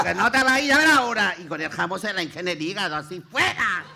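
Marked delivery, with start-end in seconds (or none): 0.0-1.3 high, loud threatening yell; threatening the conman while grabbing the ham; acted threat. 3.3-3.8 acted shout. Chasing him with ham.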